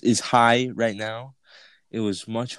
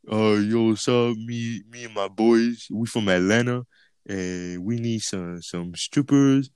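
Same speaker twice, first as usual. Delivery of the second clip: mocking a boy straining to sound deeper; fake deep voice. Friend imitating his pal's prank call, fake deep voice. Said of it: "trying to make his voice sound deeper".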